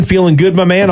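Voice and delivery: ordinary talk, nothing marked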